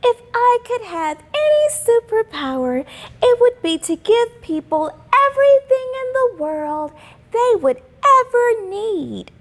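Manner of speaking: put-on high, bright little-girl voice; hopeful schoolgirl wishing aloud; young girl Simone. A narrator's reading. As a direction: read it as a dreamy young girl. Simone's daydream quoted in story.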